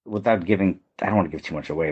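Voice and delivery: ordinary delivery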